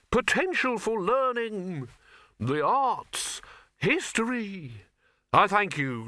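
high, slow, grand showman. A narrator's reading. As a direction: announce it like a fairground barker. Showman continues his pitch.

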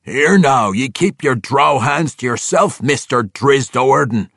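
loud gruff dwarf sneering; dwarf warning the drow off, contemptuous; open contempt. Acted. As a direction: spit the name out with scorn. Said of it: "he said with open contempt".

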